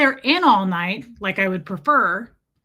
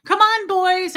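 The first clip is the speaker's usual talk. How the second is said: higher, louder sing-song call; calling cats; 10 semitones higher, 6 dB louder, slower than usual. Retold or imitated. She calls her cats home from the porch, mild sing-song. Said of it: "and I'm like".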